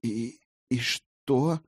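slow, stammering question; hesitant, intimidated stammer; timid babble. A narrator's reading. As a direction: falter on the first word. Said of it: "пролепетала Райна".

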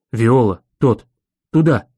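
short, punchy dog-voice bursts; blurting dog. A narrator's reading. Dog urging his master toward Viola, blurted bursts. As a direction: blurt each word as a dog would. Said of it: "бухнул Мэнчи".

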